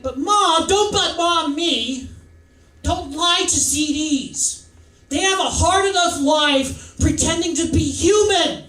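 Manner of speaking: loud, high New York mom scolding; slow, emphatic New York motherly rebuke; New York mother accent. Retold or imitated. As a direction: lay into the kid in brash New York. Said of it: "I gave her a New York accent".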